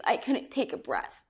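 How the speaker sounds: ordinary delivery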